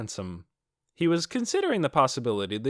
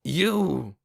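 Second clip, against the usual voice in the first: sharp accusing cry; a harsh, accusing shout; 5 semitones higher, 3 dB louder than usual. Acted. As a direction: spit it at her. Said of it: "the harshness in his voice".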